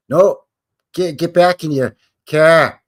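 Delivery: loud, slow hammed-up refusal; mock dismissal. Bostonians sending the Acadians away, loud mock refusal. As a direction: order them back to the car.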